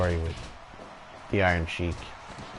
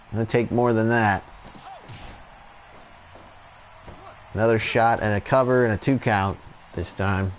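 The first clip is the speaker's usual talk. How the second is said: steady wrestling commentator call; live play-by-play; 3 semitones higher, 4 dB louder than usual. Acted. Commentator calling the tag match for viewers, play-by-play. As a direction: call each move as it lands.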